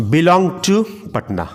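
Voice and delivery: teacher modelling slowly; slow dictation. Shown as a demonstration. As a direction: say it slowly so students can copy. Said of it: "अब हम बताते हैं कि".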